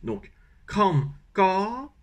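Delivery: kram ka said very slowly with ka rising; slow Vietnamese demo. Shown as a demonstration. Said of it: "Donc ka".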